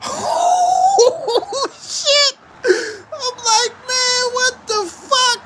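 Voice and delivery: high, laughing shock at the free cash; cracking up while saying it; laughing disbelief. Retold or imitated. Cash machine spitting out money.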